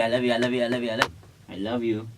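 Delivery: ordinary talk, nothing marked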